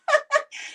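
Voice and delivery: high burst of real laughter; amused high laugh. Real, not acted. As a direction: break into a laugh at the memory. Laughing at stepdad's outburst.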